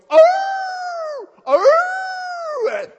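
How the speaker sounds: loud high-pitched hound howl; bay like a hunting dog, long and high; imitated hound baying. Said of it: "They go".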